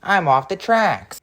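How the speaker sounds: high, slow dismayed cry. Acted. Toby derailed after the crash, dismayed cry. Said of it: "cried Toby".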